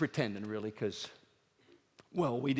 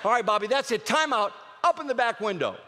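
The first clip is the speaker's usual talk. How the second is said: stern dad; 7 semitones higher, 4 dB louder than usual. Retold or imitated. Dad giving timeout in car.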